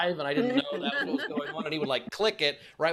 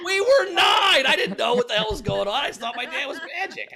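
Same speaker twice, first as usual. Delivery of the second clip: high, loud, laughing shout; exasperated protest; 9 semitones higher, 7 dB louder than usual. Real, not acted. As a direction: cry out in amused self-defence, drawing out the first words. Laughing, exasperated defence of childhood naivety.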